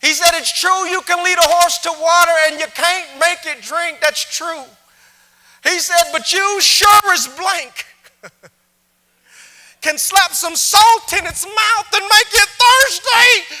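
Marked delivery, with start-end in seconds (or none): 0.3-4.6 high, loud proud cowboy boast; proud rancher. Retold or imitated. Texan rancher boasting to the speaker, high and loud. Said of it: "awesome Texan rich accent that I wish I could capture". 6.1-13.6 high, loud and slow, laughing punchline; punchline shout. Retold or imitated. Texan rancher delivering his salt punchline to the speaker, slow and loud. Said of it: "awesome Texan rich accent that I wish I could capture".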